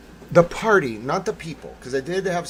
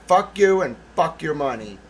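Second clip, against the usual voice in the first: loud, defiant kiss-off; retold angry dismissal; 3 semitones higher, 9 dB louder than usual. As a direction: tell off a rich donor loudly. Retold quarrel with the donor.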